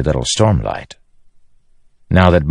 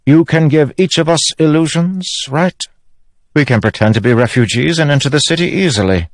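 king's voice, pitched much higher than narration; Elhokar; 11 semitones higher than usual. A narrator's reading. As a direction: propose the plan high and quickening.

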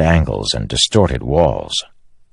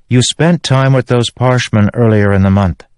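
lightly voiced character, higher than narration; Shallan; 7 semitones higher, 4 dB louder than usual. A narrator's reading. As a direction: cut in with a reason, pitch raised.

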